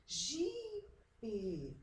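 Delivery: "jipe" with JI held long; stress on JI. Shown as a demonstration. Teacher shows where it is stronger, stressing JI in "jipe". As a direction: stretch JI, then drop PE in "jipe". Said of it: "mais forte".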